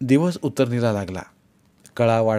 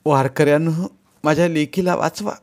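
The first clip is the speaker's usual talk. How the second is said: higher, louder desperate cry for help; desperate plea; 6 semitones higher, 3 dB louder than usual. Acted. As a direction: beg the pilgrims to save her daughter. Mother falls at his feet begging. (मुलीसाठी कळवळून केलेली आर्त विनवणी)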